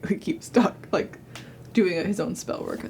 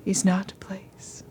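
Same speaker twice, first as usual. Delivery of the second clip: dreamy distant voice; 3 semitones higher than usual. Acted. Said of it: "you just hear this ethereal voice".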